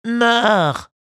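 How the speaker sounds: high, loud echoing henchman. Acted. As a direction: parrot the boss with glee. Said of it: "répéta l'autre".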